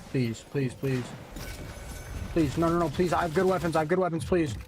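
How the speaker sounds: desperate begging, dropping low; anguished pleading. Real, not acted. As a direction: plead for your life. Begging to survive in game.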